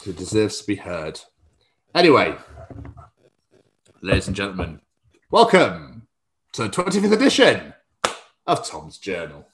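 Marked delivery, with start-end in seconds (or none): 4.0-9.5 announcer voice. Acted. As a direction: announce it like a gameshow host. Announcing show opener.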